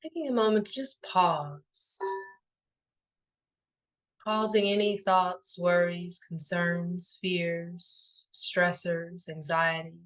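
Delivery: unhurried, soothing and evenly paced; guided meditation. Acted. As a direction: guide them gently into stillness, phrase by phrase.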